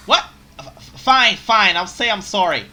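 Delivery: loud flustered stammer. Acted. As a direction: give in in a panic. Flustered giving in.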